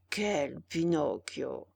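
low, slow, unhurried creature; lightly voiced slow slug. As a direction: drag it out like a sleepy slug. Slug's slow reply.

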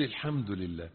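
soft, unhurried, sincere thanks; heartfelt praise. Shown as a demonstration. Preacher showing how to thank God with presence, soft and sincere. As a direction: say it quietly, meaning every word. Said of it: "التركيز في الذكر، انك تقول".

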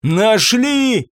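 high, desperate exclamation; near tears. A narrator's reading. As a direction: cry it out as if close to tears. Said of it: "Юбер чуть не плакал".

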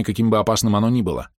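plain reading voice

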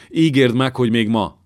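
mother begs the king for a messenger today; desperate plea. A narrator's reading. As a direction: push the demand out.

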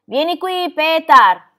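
high, loud summons; calling out. A narrator's reading. Girl calling the goatherd over, loud insistent shout. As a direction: shout for someone to come over. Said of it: "Heidi lo chiamò".

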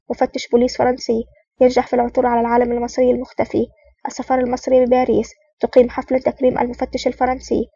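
ordinary delivery

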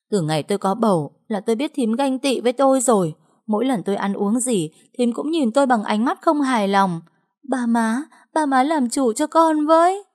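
high, pleading wail; whining lament. Acted. Trâm wailing to her parents-in-law, tearful whining plea. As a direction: play the wronged victim to the in-laws. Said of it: "khóc lóc kêu than".

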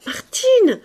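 Martine called very high; high calling. A narrator's reading. Young woman calling maid, high. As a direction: call the name up high. Calls name twice.

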